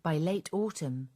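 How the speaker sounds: ordinary delivery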